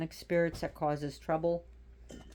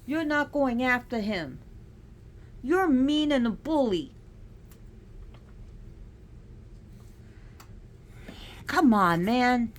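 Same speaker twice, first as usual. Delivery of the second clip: higher and louder exasperated scolding; scolding the cat; 6 semitones higher, 4 dB louder than usual. Real, not acted. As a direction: tell the bully cat off.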